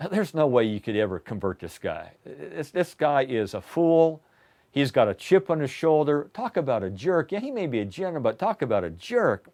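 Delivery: under the breath, scornful of the proud general; quiet scornful mutter. Pretend. Onlooker muttering about the proud general, quietly scornful. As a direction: mutter it low like a disgusted onlooker. Imagined audience muttering disdainfully.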